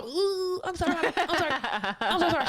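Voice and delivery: high, loud, laughing mock whimper; panicked apology. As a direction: reenact frantic apologizing to mom. Retold panicked apology to mom.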